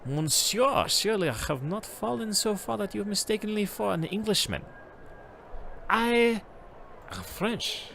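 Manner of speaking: put-on haughty French accent, offended at being called English. Reading to the stream, a Frenchman insulted at being taken for English, French accent. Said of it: "it's the accent I'm kind of using".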